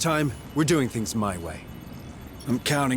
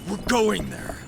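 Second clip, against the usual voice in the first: urgent command; 8 semitones higher than usual. Acted. Sergeant orders coordinates sent.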